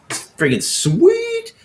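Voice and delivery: showing off a painted miniature, excited. Real, not acted. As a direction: drag it out, thrilled with the model. Showing off his roadster.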